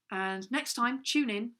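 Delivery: normal talking voice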